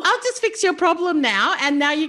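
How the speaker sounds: high-pitched, teasing imitation; playful helper impression. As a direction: voice an over-helpful counsellor in fun. Listener teases, voicing the helper.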